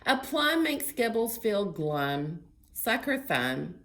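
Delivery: loud, lively picture-book read-aloud; reading to children. Acted. Librarian reading a story to children, lively. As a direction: read it big and bright for little ones.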